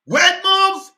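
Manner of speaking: high, loud challenge to what was heard; incredulous outcry. Real, not acted. Commentator reacts to the politician's clip, incredulous outcry. Stops clip, exclaims in disbelief.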